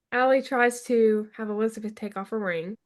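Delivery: normal talking voice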